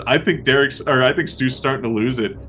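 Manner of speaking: ordinary delivery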